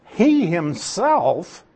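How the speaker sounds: "he himself" drawn out slowly with weight; slow, deliberate emphasis on "he himself"; emphatic stress. Shown as a demonstration. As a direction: lean hard and slow on "he himself". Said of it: "autos is an emphatic pronoun".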